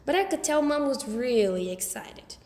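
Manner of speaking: plain reading voice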